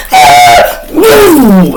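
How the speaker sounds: loud mock gunshot cry; high yelled gunshot sound; imitated gunfire shout.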